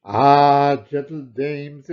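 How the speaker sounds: usual speaking voice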